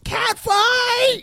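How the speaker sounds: high shrill shout of the catchphrase. Retold or imitated. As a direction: yell it like a wrestling announcer. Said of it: "popularized the catchphrase".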